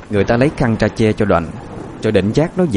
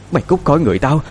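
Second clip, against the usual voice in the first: high, possessed-sounding threat; high mad woman's demand; deranged demand; 6 semitones higher, faster than usual. A narrator's reading. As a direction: order the spirit out, crazed but not yet shouting. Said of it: "miệng bà ta lại lẩm bẩm".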